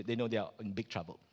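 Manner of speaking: ordinary talk, nothing marked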